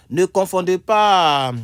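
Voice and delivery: loud slow classroom heading; emphatic announcement. Shown as a demonstration. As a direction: call out the heading like a teacher. Teacher announcing each word pair.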